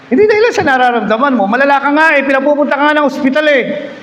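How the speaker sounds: fervent sermon climax; rising preaching. Acted. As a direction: drive the point up to the crowd.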